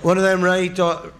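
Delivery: put-on drunk bloke bellowing his order. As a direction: shout for the hottest curry like a drunk. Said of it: "drunk orders curry in cafe".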